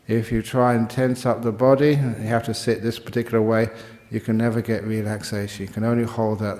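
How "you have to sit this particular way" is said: normal talking voice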